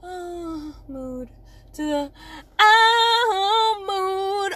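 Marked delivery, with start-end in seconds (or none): 0.0-1.3 soft, small sighed sound; low-key mood. Shown as a demonstration. Showing listeners the range of moods, soft and understated. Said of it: "the moods from". 2.6-4.5 high, loud, drawn-out swell; big belted mood. Shown as a demonstration. Showing listeners the range of moods, big and belted. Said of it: "the moods from".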